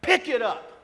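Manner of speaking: bossy command at full volume; barked order. Retold or imitated. Boss ordering the worker to pick it up, loud.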